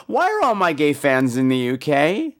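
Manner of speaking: ordinary talk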